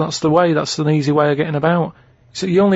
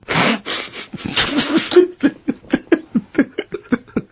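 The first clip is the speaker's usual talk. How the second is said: long, high wheezing laugh; helpless laughter; 12 semitones higher than usual. Real, not acted. Cracking up at the nil demand clue, long high laugh.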